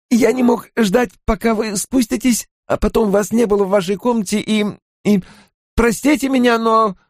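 very high, faltering excuses; breathless flustered innkeeper. A narrator's reading. Innkeeper explains himself to the lord, breathless and flustered. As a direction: stumble eagerly through a breathless apology. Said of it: "едва дыша промолвил Куале".

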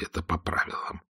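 Это по правилам whispered, no voice at all. Acted. Stirlitz warns of bugs in a whisper. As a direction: breathe Это по правилам as a secret. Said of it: "тихо ответил Штирлиц".

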